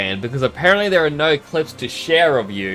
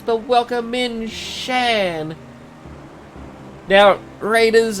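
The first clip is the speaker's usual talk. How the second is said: excited welcome; 11 semitones higher, much slower than usual. Real, not acted. Welcoming raiders to the stream.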